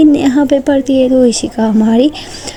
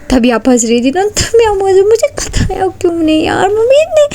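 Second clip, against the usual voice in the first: whiny embarrassed; 8 semitones higher than usual. A narrator's reading. Neha whining, embarrassed.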